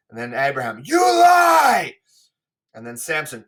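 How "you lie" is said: high, loud accusing yell; shouted "You lie!" to start the brawl; furious shout. Acted. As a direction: hurl it at him. Abraham starts the fight.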